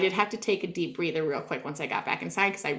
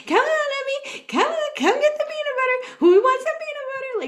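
much higher sing-song coaxing call; coaxing dog; 3 dB louder than usual. Retold or imitated. Doing a peanut butter dance to coax the dog, sing-song coaxing. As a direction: lure the dog in with a bright, high-pitched plea.

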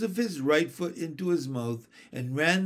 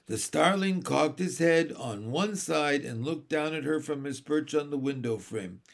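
ordinary delivery, nothing marked

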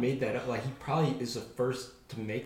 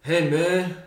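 mocking modern greeting, high and loud; modern-day casual; 6 semitones higher, 10 dB louder than usual. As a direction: send up how people talk now. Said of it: "Now it's like".

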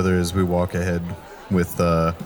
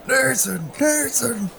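calling out; much slower than usual. Acted. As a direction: yell the name across the street. Calling out from outside.

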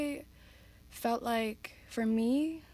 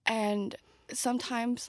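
ordinary talk

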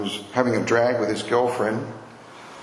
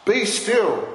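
Jesus commanding; 7 semitones higher, much slower than usual. Acted. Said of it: "But Jesus spoke".

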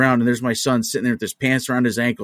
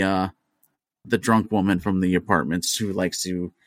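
usual speaking voice

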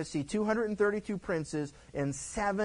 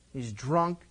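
ordinary talk, nothing marked

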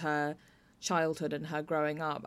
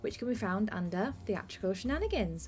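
bright, higher host sign-off over music; podcast outro; 4 semitones higher than usual. Acted. Host signing off the episode to listeners, in a bright announcer voice. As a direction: wrap up the show like a radio host.

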